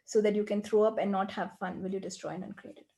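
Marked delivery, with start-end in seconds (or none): 1.8-2.9 quick, soft, murmured recital of a set formula; rote clearing formula. Acted. Coach reciting the clearing statement for the client, quick and soft. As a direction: rattle off the clearing words under your breath.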